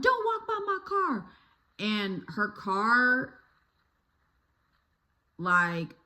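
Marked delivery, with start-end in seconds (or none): 0.0-1.3 high, far-off voice playing the angry old neighbor; mock angry neighbor. Storyteller imitating the neighbor shouting at a kid. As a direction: snap it like the cranky neighbor lady.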